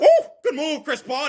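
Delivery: high, loud burst of surprise; excited, pitch jumping up on the ooh; excited reaction. Real, not acted. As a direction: whoop at a great basketball move. Reacting to a great move.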